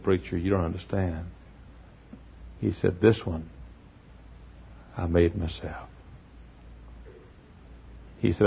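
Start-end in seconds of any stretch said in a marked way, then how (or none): 0.0-5.9 much softer, lower, heartfelt; hushed sincere admission; quiet earnest friend. Retold or imitated. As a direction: confide it gently. Friend explains he built it.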